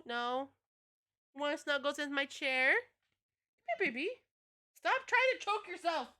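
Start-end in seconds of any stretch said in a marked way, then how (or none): 2.7-6.1 high coaxing pet voice. Real, not acted. Streamer coaxing her cat in high baby talk. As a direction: sweet-talk the cat over.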